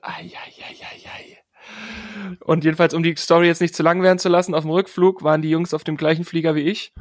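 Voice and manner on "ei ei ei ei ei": whispered slow ei ei ei; hushed secondhand embarrassment; cringing whisper. Retold or imitated. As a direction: wince under your breath. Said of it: "ich dachte".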